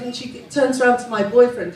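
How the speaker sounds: ordinary talk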